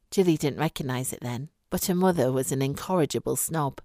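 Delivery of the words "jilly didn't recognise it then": ordinary delivery, nothing marked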